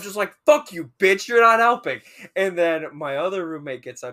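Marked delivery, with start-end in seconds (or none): 0.5-2.0 loud, high, exasperated comeback; retold angry retort. Student answering unhelpful drunk friend, loud and angry. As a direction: fire back at full volume.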